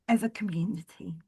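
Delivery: hushed whisper. Pretend. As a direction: drop to a whisper. Whispered tag.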